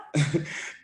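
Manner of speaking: disbelieving laugh. Real, not acted. Laughing at the stolen car.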